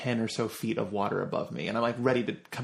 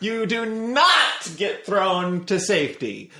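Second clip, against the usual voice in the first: higher-pitched, drawn-out mock outrage; emphatic proclamation; 7 semitones higher, 4 dB louder, slower than usual. Real, not acted. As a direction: hammer the point home, slowly and high. Emphatically proving a point after letter.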